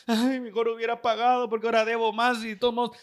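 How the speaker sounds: put-on weepy lament; mock crying. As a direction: imitate someone tearfully full of regret. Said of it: "después estás llorando".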